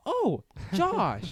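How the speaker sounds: high, loud burst of recognition; mock delighted greeting. Acting out bumping into fake Josh, mock delighted. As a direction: hail an acquaintance, hammed-up and high. Acted reunion with a fooled stranger.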